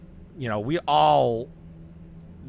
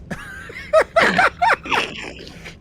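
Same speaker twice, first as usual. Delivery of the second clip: loud, very high helpless laugh; big laugh; 6 dB louder than usual. Real, not acted. Recalling friends' drive home anger, loud helpless laughter. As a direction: burst out laughing uncontrollably. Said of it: "hurting from laughing so hard".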